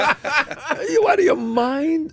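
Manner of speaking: high, laughing mock outrage at the news; mock disbelief. Friends ribbing him about getting married, high laughing mock shock. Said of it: "my friends go".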